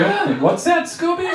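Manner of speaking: puzzled question in a high squeal; high-pitched mock question. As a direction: ask it way up high, as if to a dog. Mock reply to muffled Scooby-Doo talk.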